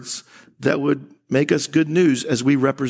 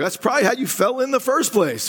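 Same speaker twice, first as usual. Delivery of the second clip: quick sarcastic jab; 9 semitones higher, faster than usual. Retold or imitated. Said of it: "You're like".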